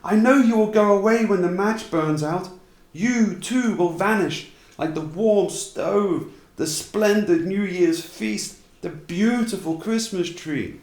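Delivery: put-on high, slow little girl's voice, pleading; child's plea. A narrator's reading. Little match girl begging her grandmother, high, slow child voice.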